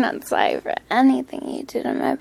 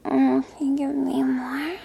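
whispering; 4 dB quieter than usual. Acted. Whispered request.

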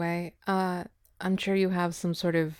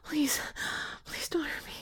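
terrified begging; 5 semitones higher, 11 dB quieter than usual. Acted. Begging, fading to a whisper.

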